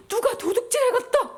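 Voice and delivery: high-pitched acted panic; dismayed, pitched well up; alarmed outcry. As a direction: cry out that you have been robbed. Mary thinks the body was stolen.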